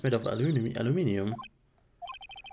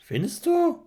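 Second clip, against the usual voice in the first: high-pitched German question. Acted. German question voiced by partner.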